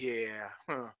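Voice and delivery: a barely voiced doubtful yeah; unconvinced murmur. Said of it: "I'm sitting there back like".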